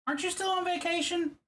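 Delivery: high, playful squeak; squeaky voice. Shown as a demonstration. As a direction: ask it in a high silly voice. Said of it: "I don't know why I'm saying it like that".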